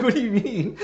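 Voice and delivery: high-pitched mock astonishment; incredulous. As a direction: squeak it out in mock shock. Retold disbelief after laughing.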